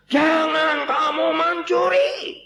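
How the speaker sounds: high, slow shouted command; loud shout. Acted. Missionary shouts the eighth commandment at villagers. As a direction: cry it out slowly to the crowd. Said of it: "Keras berteriak".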